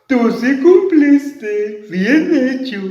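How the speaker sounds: voz de monstruo lenta y más aguda; monstruo satisfecho. Acted. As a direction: monstruo complacido, sin prisa. Monstruo habla a la niña. (monstruo contento, voz lenta)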